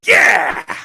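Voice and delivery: high, loud hammed-up shout; mock cheer. Comedian cheering the milk answer, loud mock shout. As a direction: cheer like a hype man. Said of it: "Foley's like".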